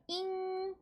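잉 held long and high; drawn-out "ing" sound. Shown as a demonstration. Teacher sounding out I-N for learners, 잉 held long. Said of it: "잉 소리로 해주시고".

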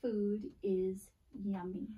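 slow, word by word; slow reading aloud. Shown as a demonstration. Teacher reading a board sentence to the class, slow and clear. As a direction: read it out slowly for the kids. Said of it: "It reads".

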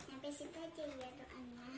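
usual speaking voice

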